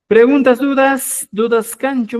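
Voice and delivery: calling out. Real, not acted. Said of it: "calling for class questions".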